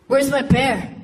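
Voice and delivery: lightly voiced brother asking for his bear; quick, restrained demand in a brother's voice; upset brother. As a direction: imitate a macho man missing his teddy. Brother asks mom for washed teddy.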